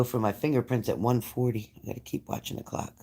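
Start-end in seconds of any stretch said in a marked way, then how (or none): 1.8-2.9 whispered to herself, unvoiced and much softer; whispered aside. Real, not acted. A quick whispered note to herself about the clock. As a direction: breathe it as a private aside.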